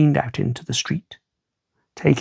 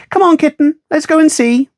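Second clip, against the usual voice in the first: put-on high, louder boyish call to the cat; eager boy calling; 11 semitones higher, 4 dB louder than usual. Pip urges Kitten to come.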